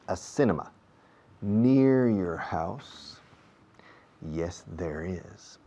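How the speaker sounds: teacher's slow model answer; slow teaching demo. Shown as a demonstration. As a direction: drag out each word for learners. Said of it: "slow question and answer demo".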